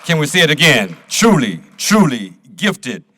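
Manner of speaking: loud, emphatic call to the crowd; rousing host. Real, not acted. Host echoing the poet to the audience, loud and rousing. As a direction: punch each word out to the whole room.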